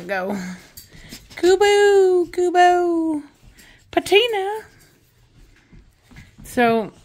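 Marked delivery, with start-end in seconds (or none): 1.4-4.6 high, loud call of the dogs' names; calling the dogs off her, high and loud. Real, not acted.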